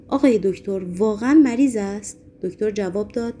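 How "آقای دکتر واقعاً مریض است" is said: plain reading voice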